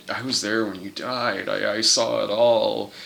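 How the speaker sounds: low, hushed, grave confession; mock-solemn quiet witness; Clavius. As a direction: say it soft and low.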